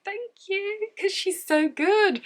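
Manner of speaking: high-pitched, drawn-out gush; gushing thanks. Retold or imitated. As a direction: squeak it out high, stretching the praise. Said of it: "I'm like".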